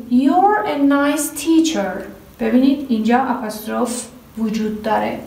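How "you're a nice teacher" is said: very slow, each word separate; slow example reading. Shown as a demonstration. Teacher introducing the next example, very slowly. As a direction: read the example slowly as dictation. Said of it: "مثال بعدی".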